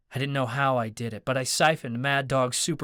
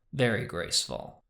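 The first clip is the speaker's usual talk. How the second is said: deadpan; 4 semitones lower than usual. A narrator's reading. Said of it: "O'Brien deadpanned".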